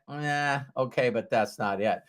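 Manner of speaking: soft, half-hearted brush-off; softly dismissive, dropping away. Retold or imitated. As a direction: imitate a quiet, unconvinced group turning it down. Group brushes off Matt's idea.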